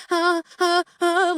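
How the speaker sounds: put-on high, small panicked child sounds; childlike panic. Imitating anxiety as a panicked child, high and small. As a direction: whimper like a scared little kid. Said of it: "manifests as a childlike".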